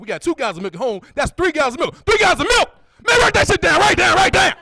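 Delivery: high, loud, overexcited yelling; excited friend. Retold or imitated. Friend yelling at comic to write jokes down, overexcited. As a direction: hype it up like an overeager buddy. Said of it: "he get excited".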